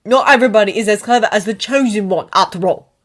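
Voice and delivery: loud, sneering sarcasm; mock him as the clever one. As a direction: Ron sneering at Harry, loud. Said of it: "Ron sneered".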